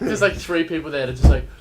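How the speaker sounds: ordinary delivery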